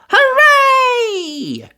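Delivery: cheer. A narrator's reading. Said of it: "everyone cheered".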